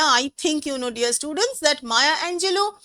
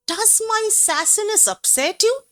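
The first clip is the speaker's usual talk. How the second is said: high-pitched, taunting rhetorical question; sarcastic challenge, pitched high; 7 semitones higher than usual. Acted. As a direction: needle the critics with a mock question. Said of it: "her tone is very sarcastic".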